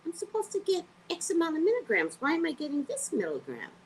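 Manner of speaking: high, indignant questioning; indignant demand. Retold or imitated. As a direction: challenge the clerk, affronted. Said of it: "And I was like".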